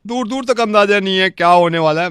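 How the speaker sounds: ordinary talk, nothing marked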